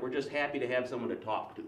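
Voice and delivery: usual speaking voice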